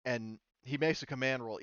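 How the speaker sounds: usual speaking voice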